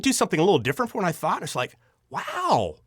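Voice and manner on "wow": high delighted wow; impressed. Retold or imitated. Director praising an actor's surprise take, impressed.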